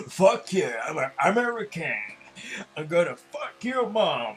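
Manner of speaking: put-on loud, over-the-top American accent, slow at the end; hammed-up American accent. Gamer mocks NASCAR fans with a loud, brash American accent. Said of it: "mon accent américain".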